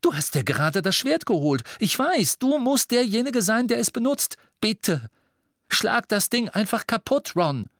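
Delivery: high, pressing plea; pleading urgently. Acted. Harry begging Ron to destroy the locket, high urgent plea. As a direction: beg him to strike now.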